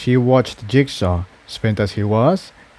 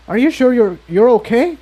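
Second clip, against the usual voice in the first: put-on higher, anxious young mare voice; worried Tiptoe; 10 semitones higher, faster than usual. A narrator's reading. Tiptoe worrying Jigsaw will burn out, high anxious voice. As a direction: ask with worried concern, voice raised high.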